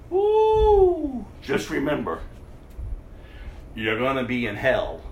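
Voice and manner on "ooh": mock gasp. Mock warning.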